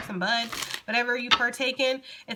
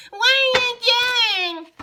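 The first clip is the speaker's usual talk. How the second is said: very high, loud, slow whiny squeal; annoying voice; 11 dB louder, much slower than usual. Retold or imitated. Reader mimicking someone's annoying voice, high slow whine. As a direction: stretch it into a grating nasal whine. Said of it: "Somebody got an annoying ass voice".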